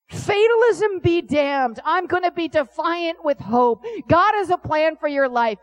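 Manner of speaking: loud, high sermon declaration; rousing preacher's cry of defiance; preaching at full force. Acted. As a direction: thunder it from the pulpit.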